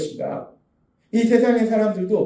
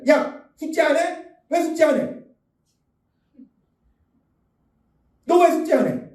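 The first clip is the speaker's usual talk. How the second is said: higher, mock scolding mother; scolding mom; 7 semitones higher than usual. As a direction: nag like an annoyed mom catching a lazy kid. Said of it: "엄마가 어린 아이한테 얘기합니다". (숙제 안 한 아이를 다그치는 엄마 흉내, 높은 목소리)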